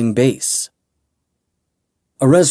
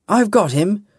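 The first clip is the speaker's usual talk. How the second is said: eager call; 10 semitones higher than usual. A narrator's reading. Giving chase.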